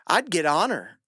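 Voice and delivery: ordinary talk, nothing marked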